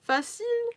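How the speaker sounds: "Facile" said the French way, /fasil/, with a high lilt at the end; French word facile. Shown as a demonstration. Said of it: "we say facile to easy in French".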